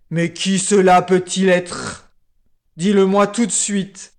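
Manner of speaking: loud, growling lion king; enraged royal demand; furious lion roar. A narrator's reading. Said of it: "devint aussitôt furieux et rugit".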